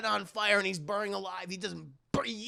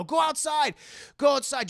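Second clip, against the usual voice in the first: higher, louder urging; acted insistence; 6 semitones higher, 4 dB louder than usual. Humperdinck sends partner outside, insistent high order. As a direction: order it twice, urgently.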